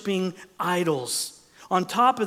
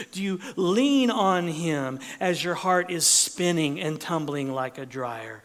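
ordinary delivery

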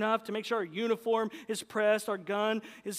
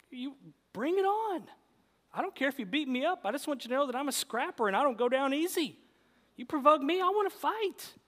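tough-guy bravado; 6 semitones higher, 4 dB louder, faster than usual. Pretend. Mock fighter bravado.

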